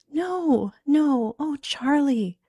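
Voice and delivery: high, drawn-out remorseful cry; anguished, tearful wail; near tears. Acted. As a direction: plead as if about to weep. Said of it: "burst into tears herself".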